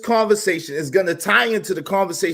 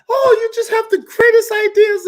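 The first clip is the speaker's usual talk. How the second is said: high, gushing sycophant voice; mocking fawning fans in a squeaky tone; mock flattery; 4 dB louder than usual. Vlogger mocking echo-chamber flatterers, high gushing voice. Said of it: "People want to just hear whatever they're saying is".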